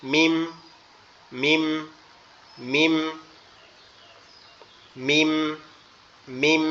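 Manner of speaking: মিম hummed gently with lips closed; soft Arabic mim. Shown as a demonstration. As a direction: let the lips meet softly on মিম. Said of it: "লেগে আওয়াজ হবে নরম আওয়াজে".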